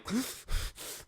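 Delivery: sharp, high unvoiced gasp of panic; sudden frightened intake of breath; panicked gasp. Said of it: "a panic attack".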